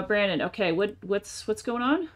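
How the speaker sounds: normal talking voice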